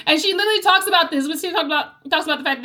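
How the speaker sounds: bursting out high, loud and fast; rushed gleeful outburst; excited. Real, not acted. Excited retelling after a laugh.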